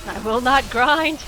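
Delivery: loud, slow, stubborn refusal; defiant shout. Real, not acted. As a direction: shout it out, dig your heels in.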